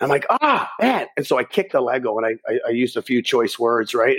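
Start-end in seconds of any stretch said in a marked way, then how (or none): 0.3-1.1 high sudden yelp of pain; a much higher pained cry. Retold or imitated. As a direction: yelp as the Lego bites your foot. Stepping on Lego.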